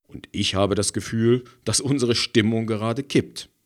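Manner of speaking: usual speaking voice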